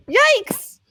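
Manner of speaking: startled yelp. Acted. Shouted reaction.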